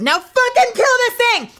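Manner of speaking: sudden high-pitched, very loud shout; acted-out battle-frenzy yell; frantic gamer yell. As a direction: scream orders in the heat of a fight. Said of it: "a juggle where I'll be like".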